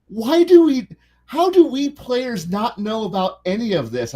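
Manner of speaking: high-pitched incredulous protest; imitating baffled player.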